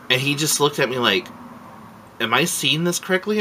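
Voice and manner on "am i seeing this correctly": high-pitched mock disbelief; incredulous retold question, quickened; disbelieving. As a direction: imitate someone who can't believe it. Officer's disbelieving look, retold.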